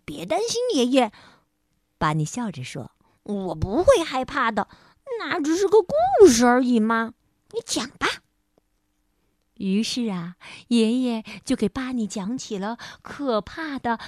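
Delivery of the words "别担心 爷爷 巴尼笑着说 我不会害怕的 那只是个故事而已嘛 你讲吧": put-on bright child voice, cheerful. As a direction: play a cheerful little boy.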